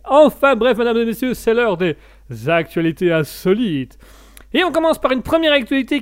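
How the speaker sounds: opening the odd-news segment with a flourish; show host announcement. Acted.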